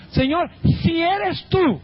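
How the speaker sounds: high, drawn-out conditional appeal; acted doubt. Peter calling to Jesus from the boat, doubting. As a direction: call out uncertainly, stretching the words. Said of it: "Expresó duda".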